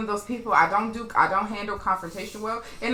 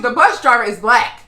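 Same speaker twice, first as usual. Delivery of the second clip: usual speaking voice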